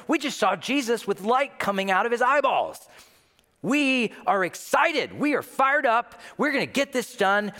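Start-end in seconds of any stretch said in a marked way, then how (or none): 3.6-7.5 hyped up. Retold or imitated. Disciples hyped after mountain.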